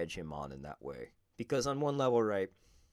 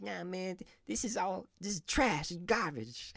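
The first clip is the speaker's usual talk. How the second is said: Mike Tyson impersonation; 13 semitones higher than usual. Retold or imitated. Podcaster imitating the boxer shrugging off his belts, much higher put-on voice. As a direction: pitch it way up like a famous heavyweight brushing off praise. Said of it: "I'm gonna do my best Mike Tyson impersonation".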